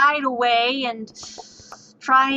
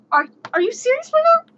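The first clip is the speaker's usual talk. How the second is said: much higher, louder incredulous cry; shrieking disbelief; 13 semitones higher, 4 dB louder than usual. Retold or imitated. Player shrieking at the samurai who rolls again. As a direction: squeal it in total disbelief.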